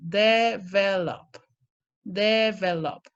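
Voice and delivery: Develop spelled out slowly as de-vel-op; slow de-vel-op for the class; syllable by syllable. Shown as a demonstration. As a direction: count out the three beats of Develop. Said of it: "three syllables".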